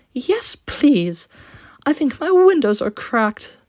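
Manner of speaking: high, loud whimper of a hurt coach. Acted. The damaged coach whimpers to Quincy, high-pitched. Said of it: "she whimpered".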